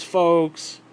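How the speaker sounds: normal talking voice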